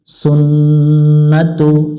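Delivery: slow সুন্নাতু with the nn held nasally; nasalised Arabic. Shown as a demonstration. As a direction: hum the double n in সুন্নাতু. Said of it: "গুণনা করব".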